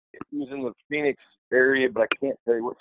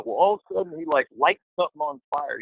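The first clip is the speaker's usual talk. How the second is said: normal talking voice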